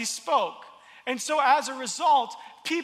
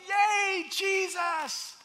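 high, bright hometown cheer; mock cheer; 8 semitones higher, much slower than usual. Preacher imagining the hometown crowd cheering Jesus, high excited cheer. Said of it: "they'd be like".

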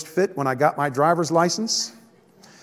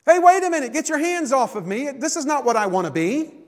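whiny clay pot; 8 semitones higher than usual. Pretend. Clay pot complaining to potter.

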